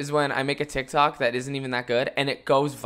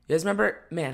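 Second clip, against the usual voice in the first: ordinary delivery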